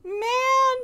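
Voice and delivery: high, drawn-out whine; whiny. Pretend. Streamer losing a Pokemon, whining. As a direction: whine like a thwarted kid. Whines about the stolen catch.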